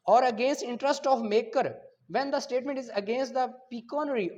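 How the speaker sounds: loud, slow dictation of legal text; slow statute reading. Acted. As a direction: spell out each clause for students to copy. Reads the statute clause.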